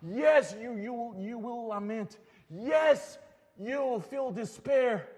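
loud, emphatic pulpit declarations; preaching at full force. Acted. Preacher urging a grieving congregation, loud and emphatic. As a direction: hammer each yes home to the congregation.

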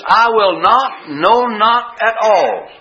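high, slow, every word pressed hard; preacher voicing Jesus's promise, drawn out with heavy emphasis; emphatic promise. Preacher voicing Jesus's vow to his hearers, slow and emphatic. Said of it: "it's with emphasis".

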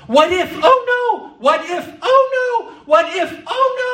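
mock panic, shrieking high; hammed-up fearful squeal on oh no; panicky what if. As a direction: squeal it like a frantic worrier. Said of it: "based off of".

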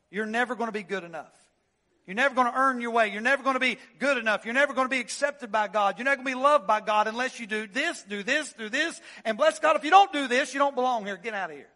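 loud, fast railing at the congregation; mock legalistic preacher. Preacher mocking a legalist railing at the church, loud fast rant. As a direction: hammer them like a hellfire legalist. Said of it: "this kind of preaching, where men get on a hobby horse".

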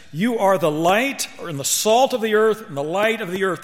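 high, loud emphasis on "You are the light"; emphatic. Acted. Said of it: "The Lord uses an emphatic phrase".

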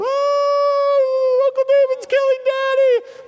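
put-on very high, loud little-girl squeal; panicked little girl. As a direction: shriek it like a scared toddler. Little daughters cry out.

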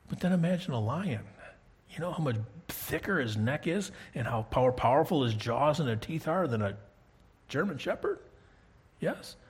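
ordinary delivery

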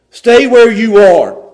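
firm command. Acted. Paul commanding Timothy to stay, loud and slow. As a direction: plant him in place.

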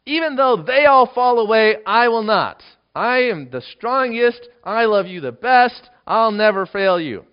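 loud, slow, high proud boast; boastful vow. Acted. Peter loudly insisting to Jesus he won't fall away, boastful. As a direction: declare it loudly like a proud disciple.

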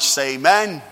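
a big, loud call for amen; loud preacher's call for amen. Shown as a demonstration. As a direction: belt out the amen. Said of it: "A little too loud there".

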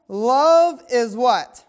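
slow, loud prompt for the answer; call-and-response question. Acted. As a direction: draw the congregation in, stretching each word.

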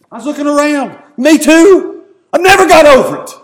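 high, loud preacher's testimony; fervent preaching. Real, not acted. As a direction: preach it out with amazed conviction. Preaches at high emotional pitch.

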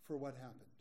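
normal talking voice

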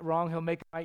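ordinary talk, nothing marked

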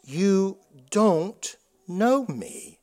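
soft and very slow; solemn verdict. Acted. Jesus telling the false follower, slow and soft. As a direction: let each word fall quietly and heavy.

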